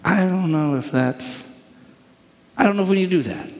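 gently balk, stretching the words; hesitant objection. Acted. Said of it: "And you're just like".

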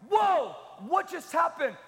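alarmed, run-for-it shout; panicked shout. Retold or imitated. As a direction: cry out as a scared churchgoer. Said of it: "fear of them going".

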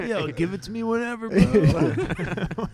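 laughing. Retold or imitated. Mimics Dave while laughing.

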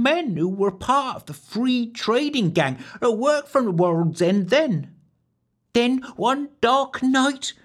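high country-maid voice spinning a ghost tale; rustic wench storytelling. A narrator's reading.

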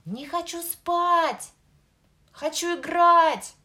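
put-on high, loud child's yell; loud shout. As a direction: yell like a stubborn little boy. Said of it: "и громко кричал". (громкий детский крик)

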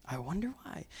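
soft dry aside; wry musing. Acted. Preacher answering the king's complaint, soft wry aside. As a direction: murmur it with a knowing shrug. Mock wonder repeated, quiet.

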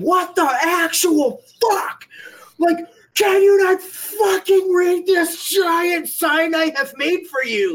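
high, drawn-out outraged scream, held back in volume; re-enacted scream. Player screaming at the shooter to read his sign, high strained yell. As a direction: scream it slowly, word by word, at a high pitch. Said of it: "I was screaming".

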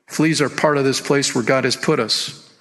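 ordinary talk, nothing marked

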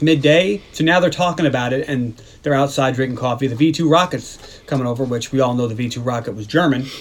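usual speaking voice

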